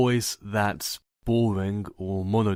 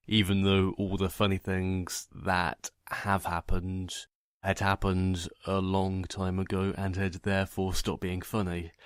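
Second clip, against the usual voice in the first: ordinary delivery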